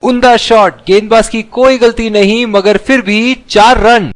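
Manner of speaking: high, thrilled boundary call; excited cricket commentary. Acted. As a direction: lift the voice for the four. Four runs, lively call. (उत्साहित cricket commentary)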